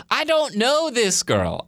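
high, slow, emphatic disbelief; retold inner objection, drawn out high; exasperated protest. Speaker protesting a stranger's invitation, exasperated.

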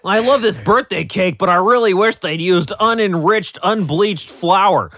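high, loud snooty mock voice; mocking picky critic. S0 mocking a picky critic, in a hammed-up snooty voice. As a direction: sneer it like a fussy food snob.

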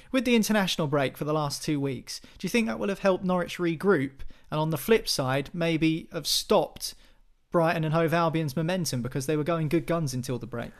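usual speaking voice

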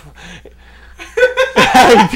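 high, loud burst of laughter. Real, not acted. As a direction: crack up at the memory. Laughing at his own story.

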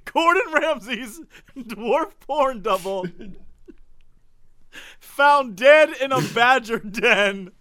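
slow, weighty news headline; headline read. Shown as a demonstration. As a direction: announce it like a newsreader. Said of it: "Let me repeat that headline".

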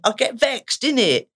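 mild imitation of a teen boy; boy's slang. Lad to therapist, lightly mimicked teen slang. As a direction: lightly voice the lad's street slang. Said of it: "He went".

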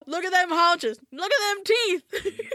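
hammed-up yokel yelling about the teeth; mock shout. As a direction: bellow it across the field, stretched out. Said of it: "they're both shouting at each other".

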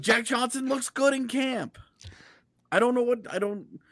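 high-pitched comic protest; mock anguish. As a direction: protest helplessly in a high, hammed-up voice. Mock anguish over praising the player.

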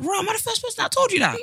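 high, fast mock-posh chirp; imitating a posh Brit, high and quick. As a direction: ham up a posh English girl.

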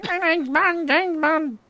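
high squeaky voice changer; like a chipmunk app filter. Pretend. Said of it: "one of those voice changer ones".

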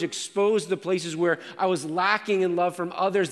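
impassioned pulpit delivery building intensity; full-force preaching. Acted. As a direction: preach it with rising urgency.

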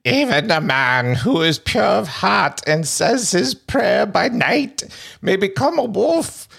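put-on high, slow old crone voice; old lady Romani voice. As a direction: quaver it out like an old fortune teller. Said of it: "in my old lady Romani voice".

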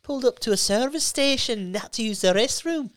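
high-pitched stand-up impression; animated high storytelling voice. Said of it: "How good is his bit about".

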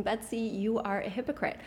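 normal talking voice

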